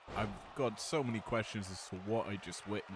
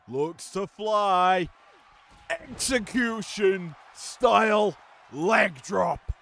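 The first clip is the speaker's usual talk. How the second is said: much higher, much louder, slow shout; higher, louder call; 9 semitones higher, 13 dB louder, much slower than usual. Shown as a demonstration. Commentator demonstrating his higher volume call, shouting. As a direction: roar the leg drop out. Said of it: "It's a little bit higher on pitch".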